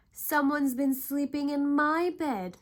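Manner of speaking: loud, high wail; Mama Bear wailing. A narrator's reading. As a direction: wail it out in distress. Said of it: "Mama Bear wailed".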